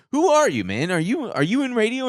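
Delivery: retelling the host's surprise; impressed host. Retold or imitated. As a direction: sound amazed by the caller. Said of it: "the guy was like".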